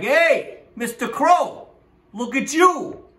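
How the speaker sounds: high, drawn-up buttery greeting; flattering fox. Acted. Fox hailing the crow with cheese, high flattering call. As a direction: lay it on, high and eager to butter up. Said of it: "and he's like, [fox to crow]".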